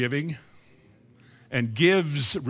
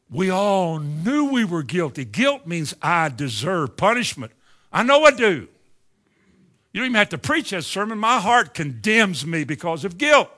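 impassioned sermon build; full-force preaching; 4 semitones higher, 4 dB louder than usual. Real, not acted. Preacher rising on guilt.

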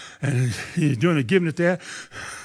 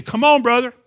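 "Come on, brother" much higher and louder, stress on "on"; congregation call-out; 8 semitones higher, 8 dB louder than usual. Retold or imitated. Church brothers loudly calling out to urge the preacher on. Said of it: "And they say".

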